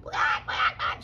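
loud hen clucking; excited hen squawk. Acted. Henny Penny carries on, squawking. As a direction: cluck loudly, all worked up. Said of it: "She got all excited".

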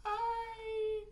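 very high, eerie creepy voice; creepy high-pitched greeting; scariest voice. Shown as a demonstration. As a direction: make it a thin, high, scary squeal. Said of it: "do the scariest voice you can".

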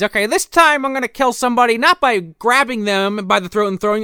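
high, hammed-up scheming villain; high mock villain voice. Rump deciding how to kill, high hammed-up scheme. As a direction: play the killer plotting, absurdly pleased. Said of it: "And then he decides".

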